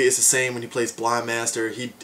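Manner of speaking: ordinary delivery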